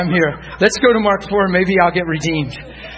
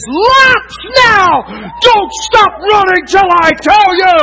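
loud, high-pitched coach's holler; screaming coach; 13 semitones higher, 8 dB louder, slower than usual. Retold or imitated. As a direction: scream orders across the field like a coach. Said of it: "he screams the little boy's name".

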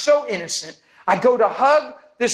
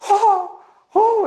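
high, slightly louder dramatic moans; 14 semitones higher, 4 dB louder than usual. Retold or imitated. Woman overcome by the Spirit moans high and dramatic. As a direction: ham up each oh like she's overcome. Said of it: "she's getting pretty dramatic".

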